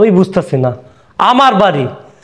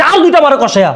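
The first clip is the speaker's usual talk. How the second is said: urging call; 6 semitones higher than usual. Acted. Father urging son to hit.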